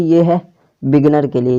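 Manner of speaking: usual speaking voice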